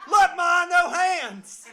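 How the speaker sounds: high, full-volume mock boast; hammed-up holler to get Mom's attention; gleeful showing-off shout. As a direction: yell it proudly like a show-off kid. Acts out childlike boast to mother.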